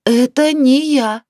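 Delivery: panicked wail, drawn out; frightened cry. A narrator's reading. Woman repeats denial to pushy neighbour, frightened cry. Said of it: "испуганно закричала в ответ Наталья".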